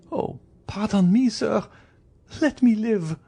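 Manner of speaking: very high, tearful-style plea; desperate high-pitched entreaty; pleading for life. Acted. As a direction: beg in a high woman's voice. Wife begs for her life.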